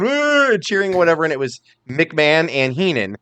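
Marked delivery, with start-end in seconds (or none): 0.0-0.5 high-pitched "woo" whoop; imitated crowd cheer. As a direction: mimic a studio crowd whooping. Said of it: "people behind them going".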